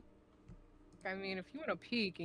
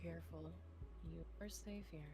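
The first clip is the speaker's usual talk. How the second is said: soft doctor voice; 3 semitones lower, 14 dB quieter than usual. Acted. Friend voices the doctor character.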